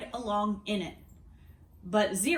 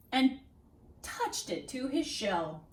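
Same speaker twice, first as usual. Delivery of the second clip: usual speaking voice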